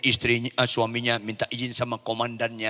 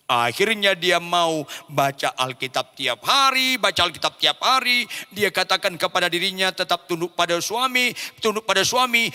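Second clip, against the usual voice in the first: preaching that climbs higher and louder; forceful preaching; 9 semitones higher, 7 dB louder than usual. Acted. Preacher telling of husband growing crueler, rising forceful preaching. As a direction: build the repetitions up to a peak.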